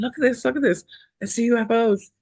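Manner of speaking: high, excited retold exclamation; urgent call. Woman retelling running to tell her husband, high urgent excitement. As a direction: call someone over urgently, pitch jumping up.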